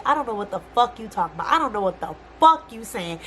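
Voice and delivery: usual speaking voice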